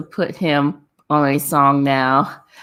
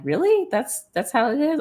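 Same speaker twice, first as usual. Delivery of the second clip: high, disbelieving squeak; mock disbelief at the friends' claim; incredulous; 10 semitones higher than usual. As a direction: act shocked, pitched way up. Mocking incredulous reply in story.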